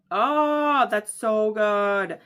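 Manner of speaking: loud, high, drawn-out swoon; gushing delight. Real, not acted. Reader reacting to a sweet scene, gushing. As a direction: stretch it out slowly, overcome with delight.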